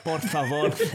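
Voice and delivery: a lisped fake Spanish "Por favor" with the TH sound; mock Spanish lisp, pleading lightly; fake Spanish accent. Friend retelling Ludwig begging, fake Spanish accent. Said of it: "fake Spanish accent".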